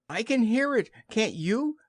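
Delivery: high, nervous squeak; squeaky small-animal anxiety; anxious Piglet. Acted. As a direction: ask worriedly in a tiny piglet voice. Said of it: "said Piglet anxiously".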